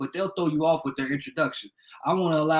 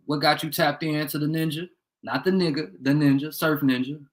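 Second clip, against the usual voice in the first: usual speaking voice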